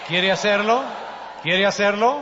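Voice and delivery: loud, rising challenge to the crowd; rallying question. Real, not acted. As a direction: call out for a big yes. Rallying congregation for an answer. (pregunta fuerte que exige respuesta)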